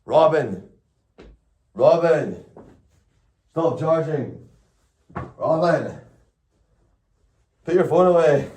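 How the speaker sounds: high, loud falling calls like an alert; beeping phone. Retold or imitated. Phone warning its owner about water while charging, high insistent calls. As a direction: nag like an insistent talking phone. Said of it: "they just started beeping at me, saying".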